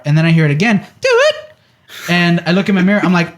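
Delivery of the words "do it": mock loudspeaker blast. Police loudspeaker blast again.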